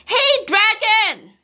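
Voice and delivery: loud, high, slow yell into his ear; shouted loudly. Acted. Princess yelling into the dragon's ear, loud shout. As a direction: bellow right into his ear. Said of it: "shouted as loud as she could".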